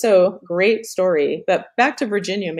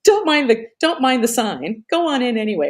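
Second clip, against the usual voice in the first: high, loud forties film-star voice; breezy old-Hollywood starlet; Virginia Mayo line; 6 semitones higher, 4 dB louder than usual. Retold or imitated. A forties starlet urging a friend into the restroom. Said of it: "Virginia Mayo says".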